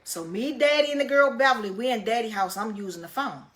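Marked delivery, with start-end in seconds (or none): none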